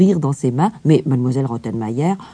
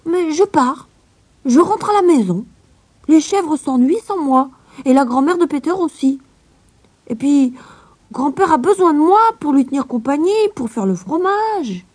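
put-on high, louder child voice; determined little girl; 12 semitones higher, 3 dB louder than usual. A narrator's reading. Heidi telling the governess she is going home, high and firm. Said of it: "Heidi leaving".

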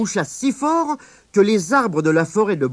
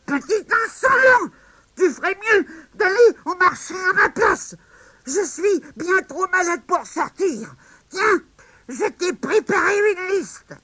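very high old woman, mock cross; granny scolding the cheeky boy; scolding grandma. Grandma scolds grandson and sends him to market.

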